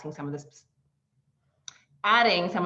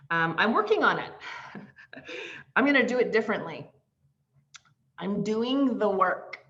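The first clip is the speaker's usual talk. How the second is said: louder, insistent "I'm working on it"; mock earnest promise; 4 semitones higher, 4 dB louder than usual. Client insisting to coach she's doing the work, emphatic. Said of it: "process only".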